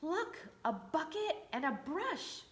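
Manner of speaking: lightly voiced beaming mayor; a hint of a proud mayor. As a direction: sound pleased with the gift. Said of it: "the mayor beamed".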